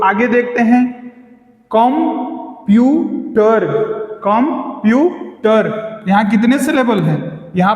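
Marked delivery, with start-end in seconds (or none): 1.8-3.7 computer split slowly as कं, प्यू, टर; three separate beats in computer: कं, प्यू, टर; syllable by syllable. Shown as a demonstration. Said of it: "तीन syllable हैं।". 4.2-6.0 computer split slowly as कं, प्यू, टर; three separate beats in computer: कं, प्यू, टर; syllable by syllable. Shown as a demonstration. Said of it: "तीन syllable हैं।".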